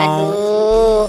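mock pained groan. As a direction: clutch the belly and moan aduh. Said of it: "perut aku jadi sakit ini".

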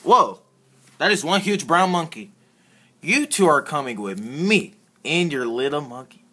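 ordinary talk

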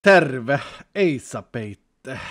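punchy Finnish, trailing into audible breath; breathy Finnish. Shown as a demonstration. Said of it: "Gotta add the breathing".

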